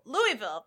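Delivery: "Louisville" as LOO-ee-vil; three-syllable Louisville. Shown as a demonstration. Host showing her own way, "Louisville" as LOO-ee-vil. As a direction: say "Louisville" with an ee in the middle, silent s. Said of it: "I say Louisville".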